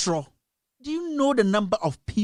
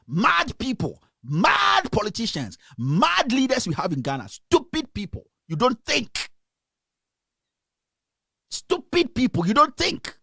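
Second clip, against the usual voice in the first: real anger, stretched stressed words; angry outburst; 3 semitones higher, slower than usual. Real, not acted. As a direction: lash out at the politicians in outrage. Angry rant at politicians.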